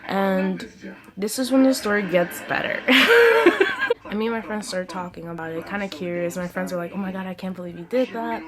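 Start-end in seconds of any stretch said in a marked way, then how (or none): 2.9-4.0 loud, high burst of laughter; real laugh. Real, not acted. Laughs before the better part.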